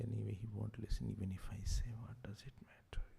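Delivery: unvoiced, resigned whisper to oneself. Someone ignored many times gives up, whispered aside. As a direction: give up and murmur it under your breath. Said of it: "slowly you say".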